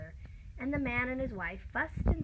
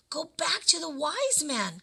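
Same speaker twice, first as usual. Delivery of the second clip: ordinary delivery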